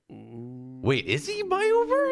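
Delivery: very high rising puzzled question; very high mock-baffled question; mock confusion. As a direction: squeak it in disbelief. Confused friend retells.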